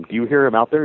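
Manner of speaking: normal talking voice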